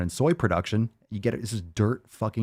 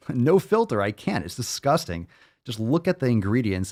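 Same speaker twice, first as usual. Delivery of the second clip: normal talking voice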